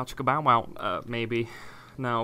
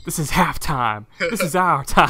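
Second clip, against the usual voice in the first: coach pep talk; 3 semitones higher, 6 dB louder than usual. Pretend.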